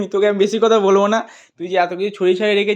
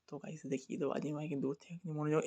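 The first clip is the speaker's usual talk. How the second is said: hushed voice; 5 semitones lower, 22 dB quieter than usual. Real, not acted. Sneaking up on busy brother.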